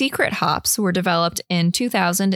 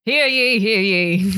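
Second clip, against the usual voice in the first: town crier; 3 dB louder, slower than usual. Pretend. Mock proclamation before opening beer.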